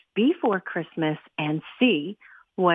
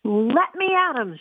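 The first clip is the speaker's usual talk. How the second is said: ordinary delivery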